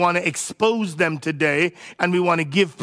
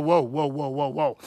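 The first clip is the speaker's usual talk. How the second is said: low, softer string of protests; taken aback; 6 semitones lower, 4 dB quieter than usual. Acted. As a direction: pump the brakes, dropping the voice. Acts parent balking at homeschooling.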